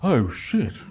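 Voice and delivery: a mild mock dismayed inner voice; a hint of an inner voice going oh no; her brain's dawning realisation. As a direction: sound like the penny just dropped. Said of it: "while her brain goes".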